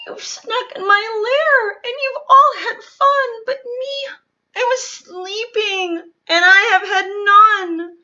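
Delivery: high, loud blubbering complaint; wailing bear. Acted. Bear to the party guests who woke him, high loud crying. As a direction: cry out the hurt of missing sleep.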